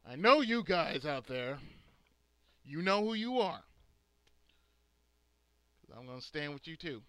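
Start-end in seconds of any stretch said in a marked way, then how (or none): 0.1-3.6 loud rallying call to viewers. Real, not acted. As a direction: call out loudly to your followers. Calling out to his viewers.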